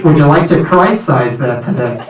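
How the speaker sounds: loud, low drive-through upsell; speaker box clerk. Pretend. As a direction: ask like a fast-food intercom.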